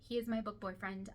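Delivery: ordinary talk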